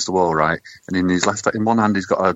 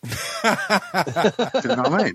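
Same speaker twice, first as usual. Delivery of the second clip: laughing; 14 semitones higher, 3 dB quieter, much slower than usual. Real, not acted. Laughing after crude joke.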